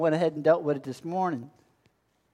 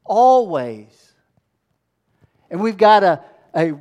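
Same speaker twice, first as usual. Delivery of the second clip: ordinary delivery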